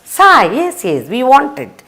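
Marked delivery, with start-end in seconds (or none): 0.0-0.5 "Sai" said higher and louder like Italian sì; bright raised "Sai". Shown as a demonstration. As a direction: call out "Sai" as the Italian yes. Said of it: "The Italians will say".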